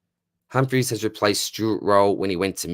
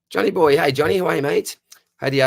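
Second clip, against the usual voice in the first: excited greeting; 9 semitones higher, 6 dB louder than usual. Real, not acted. Greeting a viewer by name.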